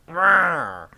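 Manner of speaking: a loud put-on growl, mildly hammed up; Penguin growl. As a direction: growl like a cartoon villain. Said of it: "most of the times, it's literally just".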